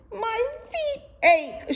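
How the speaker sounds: high, slow moan; moaning camel. Acted. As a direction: moan about her feet. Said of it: "she moaned".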